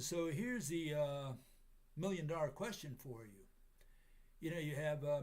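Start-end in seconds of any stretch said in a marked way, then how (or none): none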